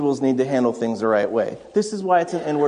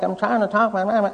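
put-on sick child's voice; 5 semitones higher, faster than usual. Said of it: "she's kinda nasally".

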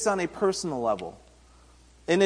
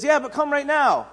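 urgent plea; 9 semitones higher, 4 dB louder than usual. Retold or imitated. Official pressing Jesus again.

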